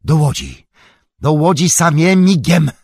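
high, loud call to hurry; frantic rallying cry; urgent shout. Acted. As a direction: shout it urgently, pitched well up. Urgent self-command to run for boats.

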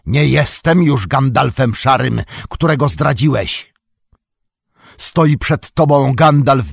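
loud, high and majestic; a wizard proclaiming his return; powerful commanding. A narrator's reading. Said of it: "krzyknął głosem potężnym i władczym". (głośno, potężnie i władczo)

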